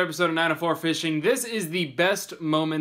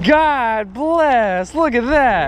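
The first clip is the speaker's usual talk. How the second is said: high, loud thrilled shout; excited; 8 semitones higher, 6 dB louder, much slower than usual. Real, not acted. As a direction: shout in delight. Fish landed, celebrating.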